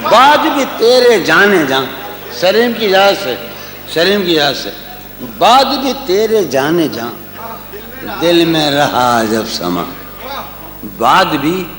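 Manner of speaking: lingering poetic recital, dropping at line ends; tender romantic poetry. Acted. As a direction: recite the couplet with feeling. Recites ghazal couplets.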